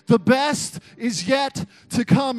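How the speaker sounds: slow, loud preacher shout; shouting the promise to the congregation; preaching at full force. Acted. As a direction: preach it to the rafters.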